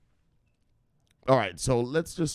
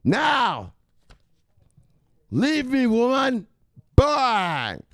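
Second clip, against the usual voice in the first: high, loud, ranting at "woman"; hyped crypto persona; 4 dB louder than usual. Acted. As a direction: preach crypto to the woman like a hype man.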